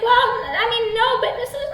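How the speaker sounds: high, stammering, breaking into a long squeak; hammed-up panicked whine; flustered squeal. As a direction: dodge him until words turn to squealing. Acted stammering squeal at creep.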